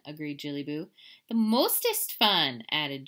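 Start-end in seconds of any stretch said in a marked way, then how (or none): 1.3-2.6 emphatic. Acted. Sister outdoing sisters.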